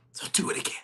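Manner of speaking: hushed breathy charge, all voiceless; whisper. Acted. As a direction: drop to a whisper and urge them. Whispered charge after the climax.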